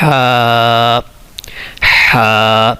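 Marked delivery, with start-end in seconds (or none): 0.0-1.0 ہا breathed from the middle of the throat, a held. Shown as a demonstration. Teacher shows students where ہا comes from, throaty. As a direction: push ہا from mid-throat, then hold the a. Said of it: "اپنے گلے کے بیچ میں سے اس کو پڑھنا ہے".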